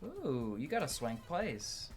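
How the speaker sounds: normal talking voice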